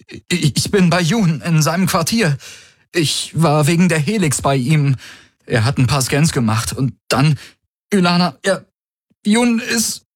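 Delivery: high, agitated, halting report; feigned breathlessness. A narrator's reading. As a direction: stumble over the words as if out of breath. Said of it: "er gab sich atemlos".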